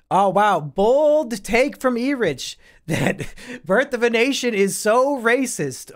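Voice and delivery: 高めの声でゆっくり皮肉っぽく; 笑いをこらえながら大げさに驚いてみせる; mock astonishment. Real, not acted. Mocks E-Rich's bold take.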